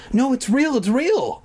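put-on boy crying out to his teacher that it's real, high and insistent; cried out. A narrator's reading. Protesting boy to teacher, high pleading cry. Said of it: "I cried".

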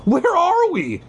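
high, frightened cry; panicked cry. A narrator's reading. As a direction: cry out in alarm. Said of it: "you cry".

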